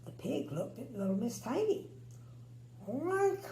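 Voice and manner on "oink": high pig grunt; grunted oink. A narrator's reading. Pig grunting at the tiny visitor. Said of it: "he grunted".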